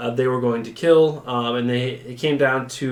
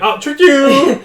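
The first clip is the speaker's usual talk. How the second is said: mock gotcha; 12 semitones higher, 9 dB louder, slower than usual. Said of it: "she should have been like".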